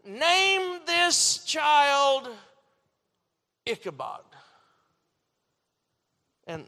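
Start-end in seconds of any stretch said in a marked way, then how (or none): 0.0-4.2 slow, drawn-out, heavy words; slow solemn naming. Retold or imitated. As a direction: pronounce the name like a grim verdict. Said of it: "she spoke up and she said".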